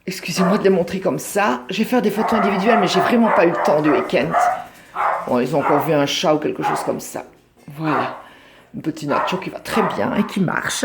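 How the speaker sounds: normal talking voice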